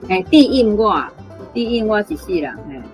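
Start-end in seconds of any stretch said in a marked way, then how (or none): none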